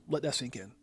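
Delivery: whisper. Real, not acted. Reacting to price prediction.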